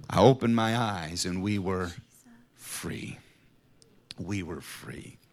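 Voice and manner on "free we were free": whispered, softer, slow and moved; breathless whisper. Real, not acted. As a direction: breathe out the freedom. Emotional release after forgiveness.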